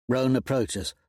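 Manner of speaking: ordinary delivery, nothing marked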